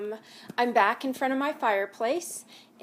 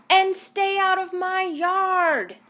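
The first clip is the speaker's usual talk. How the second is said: much higher, louder, slow grumpy order; angry yell; 8 semitones higher, 8 dB louder, slower than usual. Acted. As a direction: drive them off the yard. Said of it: "Hank yelled back".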